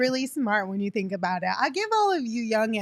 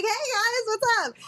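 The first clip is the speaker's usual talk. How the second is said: breezy carefree greeting; 12 semitones higher than usual. Retold or imitated. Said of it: "I'd be like".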